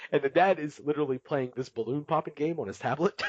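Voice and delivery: ordinary delivery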